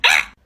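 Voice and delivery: short, loud yelp; mock outburst, cut short; exasperated yelp. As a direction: let out a sudden burst of exasperation. Stifled reaction to being told off.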